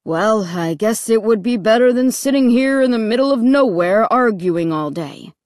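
father's grudging concession; put-on dad voice, relenting; resigned dad. A narrator's reading. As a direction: give in after a sigh, voice sinking at the end. Dad sighs and gives in.

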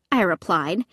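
ordinary delivery

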